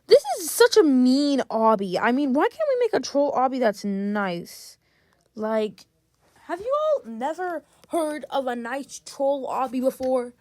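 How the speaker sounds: ordinary talk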